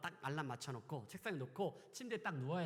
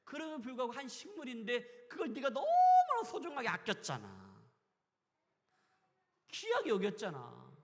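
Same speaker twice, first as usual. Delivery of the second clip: louder, higher preaching push; preaching shout; 7 semitones higher, 10 dB louder than usual. Real, not acted. Preacher pressing the congregation, shouting. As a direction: drive the point home with a shout. Preacher exhorting about Jonah.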